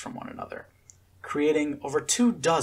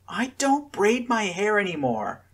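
higher pleading cry; 9 semitones higher than usual. A narrator's reading. Scarlet pleading with the twins, crying out. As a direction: beg them to stop, near tears. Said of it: "Scarlet cried".

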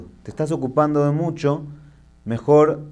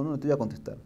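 calm refusal; 10 dB quieter, much faster than usual. Retold or imitated. Calm religious man refuses to answer.

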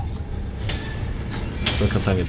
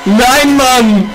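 panicked yelling; 3 dB louder, slower than usual. Real, not acted. Caught by the monster.

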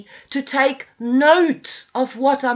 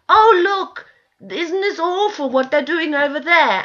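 higher, louder fake outrage; mock shocked gasp; 3 semitones higher, 5 dB louder than usual. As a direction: ham up shallow concern for show.

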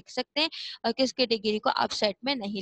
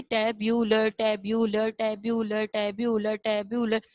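टैब, यू, लर run together over and over; quick, flowing repetition; slower than usual. Shown as a demonstration. Teacher showing pupils how repeating builds fluency, quick repetition. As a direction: chant टैब, यू, लर in a continuous drill. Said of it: "रवानगी में चार पांच मरतबा पढ़ेंगे ना जल्दी जल्दी".

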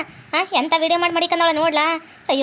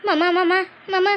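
fearful pleading; slower than usual. Acted. Girl pleading slowly with uncle.

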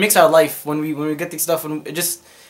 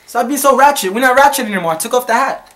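higher, louder sassy telling-off; mock scolding; 8 semitones higher, 6 dB louder than usual. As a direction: scold the baby, half joking. Scolding baby playfully.

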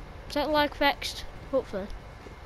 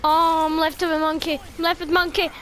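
ordinary delivery

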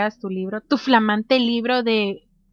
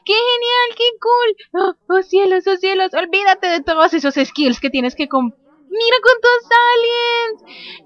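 high-pitched gushing delight; thrilled; 7 semitones higher, 4 dB louder, slower than usual. Real, not acted. Gamer marvels at how many aliens, thrilled. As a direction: gush excitedly, pitched well up.

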